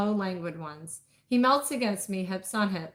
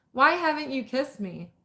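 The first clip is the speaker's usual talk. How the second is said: blurted out; 6 semitones higher, 3 dB louder than usual. A narrator's reading. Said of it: "My question rings out".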